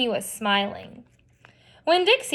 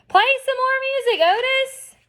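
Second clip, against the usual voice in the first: shouted over the noise; 13 semitones higher than usual. A narrator's reading. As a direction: shout it over barking and squawking. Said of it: "I shouted over Winn-Dixie barking".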